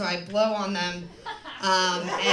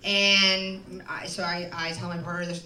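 ordinary delivery

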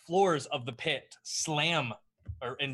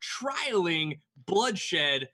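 announcer voice; 5 semitones higher, 3 dB louder than usual. Acted. Announcer placating Xanathar.

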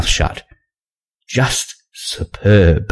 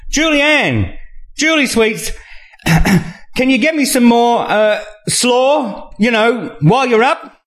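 much higher, sing-song calling out to the kitchen; bellowing Maxine. Acted. Maxine calling to Julianne from her chair, high bellowing sing-song. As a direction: holler across the house like a pushy guest. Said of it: "Maxine's voice bellows out".

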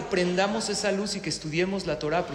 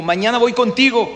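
loud, raised-pitch tsar's threat; booming storybook threat; threatening announcement; 5 semitones higher, 8 dB louder than usual. Acted. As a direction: call it out as a menacing order. Tsar threatens the next man.

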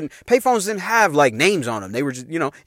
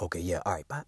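put-on much lower, soft sneaky boyfriend voice; low Tim voice; 12 semitones lower, 19 dB quieter, faster than usual. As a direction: play the boyfriend whispering plans. Voicing Tim on the phone.

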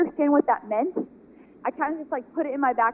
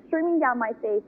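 usual speaking voice

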